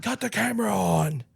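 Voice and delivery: high-pitched, half-hearted yell; mock screaming boss. Co-host joining the Triple H joke, high mock scream. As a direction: play along with a mock frantic order. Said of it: "Triple H right now is screaming".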